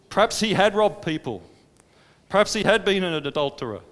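loud, rising preacher's cry; impassioned preaching. Acted. Preacher describing the tax collector's repentance, fervent and loud. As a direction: build the fervour line by line.

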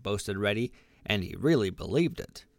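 plain reading voice

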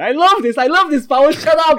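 loud, high, laughing shout at his friend; cracking up and yelling shut up; excited laughing yell. Real, not acted. Laughing at Paul's joke, a loud high delighted yell.

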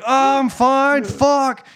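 high, slow strained brush-off; mock brush-off. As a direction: insist you're fine while clearly hurting. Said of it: "And I'm like".

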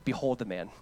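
low, soft and hushed; whisper-soft pointing to Christ; hushed, reverent reply to the accusation. Acted. Gentle answer after accusation.

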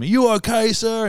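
high, urgent mock-cop voice; high-pitched pretend concern; concerned movie cop. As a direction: play an over-eager officer checking on the hero. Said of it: "some cop always runs up to him".

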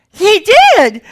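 gleeful cry of good news; excited announcement. Real, not acted. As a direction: blurt it out thrilled. Announcing the remarriage to in-laws.

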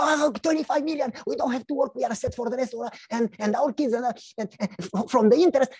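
high, breathless-with-news gushing; going crazy with excitement. Acted. Wife phones everybody about the windfall, crazily excited. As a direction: gush in a high voice, tripping over the words. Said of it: "she goes crazy".

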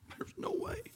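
a hushed whisper of despair. Acted. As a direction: breathe it out, no voice. Quoting Corrie's despair.

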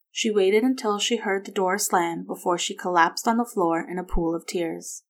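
ordinary delivery, nothing marked

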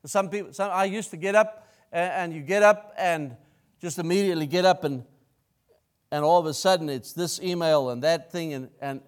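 ordinary talk, nothing marked

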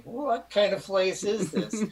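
imitated scandalized mom; shocked mom. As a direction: play a mother taken aback, slowly. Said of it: "She's going".

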